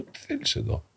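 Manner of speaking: hushed, startled whisper; surprised whisper. Retold or imitated. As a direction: breathe the question out in surprise. Priest startled by young man.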